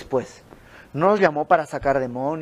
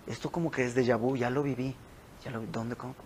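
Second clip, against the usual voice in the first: acted hushed pondering, much softer; musing to himself; 3 semitones lower, 11 dB quieter, faster than usual. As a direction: think aloud under your breath, as if placing a memory. [name] cae en cuenta, voz actuada. (pensando en voz baja, como para sí)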